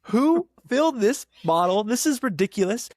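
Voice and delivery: high, loud incredulous outburst; acted indignation. Pharmacist appalled by a bottle's label, acted indignation. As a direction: replay exasperated disbelief, voice up.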